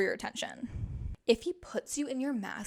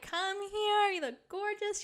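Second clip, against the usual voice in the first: much higher, eager and admiring; excited compliment; 10 semitones higher, slower than usual. Retold or imitated. Boyfriend Zach calling her over, excited and admiring. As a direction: pull her in, thrilled by the look. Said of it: "He was like".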